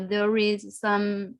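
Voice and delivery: ordinary talk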